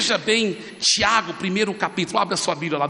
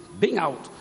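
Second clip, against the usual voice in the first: ordinary talk, nothing marked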